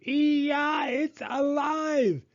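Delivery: high panicked shriek, slowed; terrified scream. A narrator's reading. Man screaming when the doll suddenly speaks, high and panicked. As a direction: shriek in horror. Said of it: "The lady screamed".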